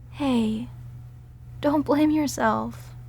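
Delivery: ordinary talk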